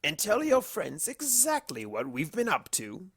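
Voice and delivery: grin through it like a villain enjoying the joke; playful evil grin. Acted. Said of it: "with a playful, evil grin".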